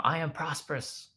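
re-enacted prompting chant; affirmation prompt. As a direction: offer it up high for a child to repeat. Dad feeds daughter the next affirmation.

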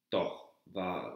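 ordinary delivery

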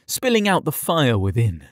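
plain reading voice

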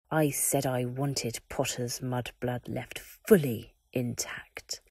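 lenient-sounding cruelty; dark lord sentencing the Warden; cold Voldemort. Acted. Voldemort pronounces punishment.

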